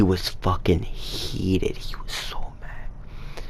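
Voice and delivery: breathy whispered aside, no voice. Real, not acted. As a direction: drop to a secret whisper. Telling of angry friend Carlos.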